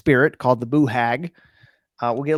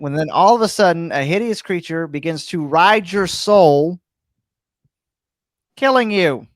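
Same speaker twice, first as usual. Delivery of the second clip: louder, rising scare at the end; spooky build-up; 3 semitones higher, 6 dB louder than usual. Shown as a demonstration. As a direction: build to a sudden fright. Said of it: "imagine with me".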